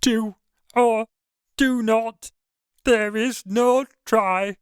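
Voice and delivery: slow, squeaky little sage; Yoda. Acted. Isaac mocks the narrator's reply, squeaky Yoda impression. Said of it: "Isaac answers in a squeaky voice".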